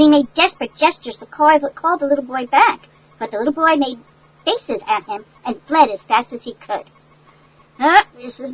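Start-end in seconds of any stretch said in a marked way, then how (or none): none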